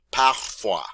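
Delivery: Parfois with French r and wa; French model word. Shown as a demonstration. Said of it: "how you say sometimes".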